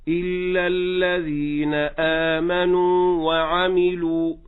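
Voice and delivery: slow Quran chant, long vowel in آمنوا before وعملوا; recited with full madd. Shown as a demonstration. Teacher reciting the verse as a model, slow chanted madd. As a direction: intone it slowly, holding every madd. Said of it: "هكذا".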